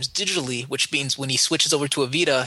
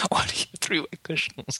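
whispering; 2 semitones higher, 4 dB quieter than usual. Real, not acted. Amused disbelief at wet cushions.

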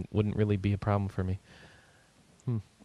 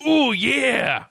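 high, strained wrestler catchphrase; hammed-up wrestler roar; Macho Man Randy Savage; 12 semitones higher, 3 dB louder than usual. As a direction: imitate a wrestler roaring like a dragon. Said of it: "turn every dragon into Macho Man Randy Savage".

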